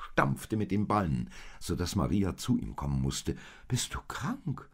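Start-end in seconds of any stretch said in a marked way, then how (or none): 3.7-4.7 slower, higher and anxious; a mother fearing her donkey is ill; worried. A narrator's reading. Maria asking her noisy donkey if he is sick, worried. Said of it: "fragte sie beunruhigt".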